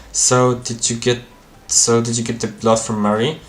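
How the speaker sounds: usual speaking voice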